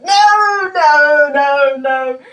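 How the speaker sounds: loud, high repeated no's; mock stern teacher. Drummer mimicking teacher Jan stopping her playing, loud mock scolding. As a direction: imitate a strict drum teacher cutting you off. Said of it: "'cause it's like".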